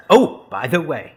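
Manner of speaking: ordinary talk, nothing marked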